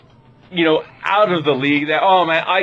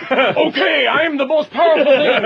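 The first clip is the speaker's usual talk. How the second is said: higher-pitched, quick villain boast; boastful villain; 6 semitones higher than usual. Acted. Big bad guy resuming his monologue, boastful and high. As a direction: launch into a big self-important proclamation.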